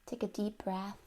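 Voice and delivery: unhurried relaxation guidance with long pauses; guided meditation. Real, not acted.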